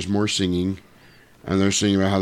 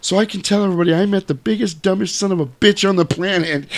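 higher, fast insulting lady; gleeful insult; 10 semitones higher, faster than usual. Retold or imitated. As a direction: rattle off the insult in a raised voice. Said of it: "then insult him at the end".

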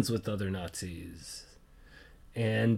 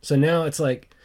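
usual speaking voice

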